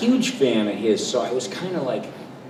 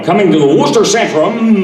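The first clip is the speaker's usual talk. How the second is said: high, loud hyped radio promo; booming concert announcer; arena ad announcer; 9 semitones higher, 10 dB louder than usual. Retold or imitated. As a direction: sell the show like a monster-truck ad. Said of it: "other guys would go".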